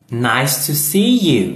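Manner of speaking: slow, clear teacher read of each greeting; careful demo voice; model English phrases. Shown as a demonstration.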